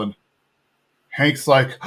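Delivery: normal talking voice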